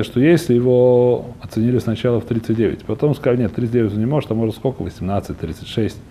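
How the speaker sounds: ordinary delivery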